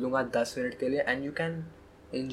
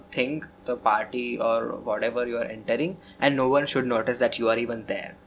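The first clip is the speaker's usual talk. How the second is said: ordinary talk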